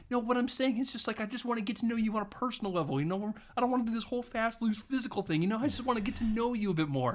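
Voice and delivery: high, rushed explanation; pleading backpedal. Retold or imitated. Man placating an angry date, high rushed pleading. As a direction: talk fast to talk your way out. Acted earnest backpedalling to woman.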